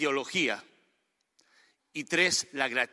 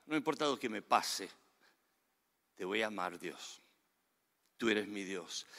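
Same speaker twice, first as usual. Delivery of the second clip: hushed tender vow; 6 semitones lower, 8 dB quieter than usual. Retold or imitated. Preacher voices David's prayer.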